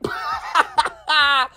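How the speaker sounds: real laugh breaking through. Real, not acted. Host cracking up at his own line, laughing. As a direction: laugh helplessly mid-read. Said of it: "don't know why I'm laughing".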